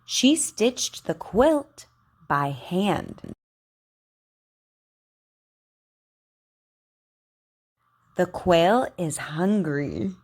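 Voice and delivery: slow, carefully shaped practice sentences; over enunciating. Shown as a demonstration. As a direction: model each Q word slowly and crisply. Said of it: "Just repeat after me".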